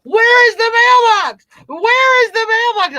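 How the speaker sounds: hammed-up frantic yell; mock panic yell. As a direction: shriek it like the world ended.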